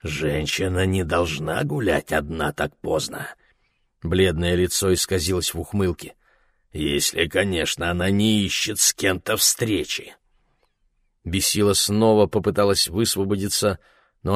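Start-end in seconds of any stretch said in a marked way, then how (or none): 0.0-10.2 put-on town drunk. A narrator's reading. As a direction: slur and leer at her. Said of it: "заплетающимся языком".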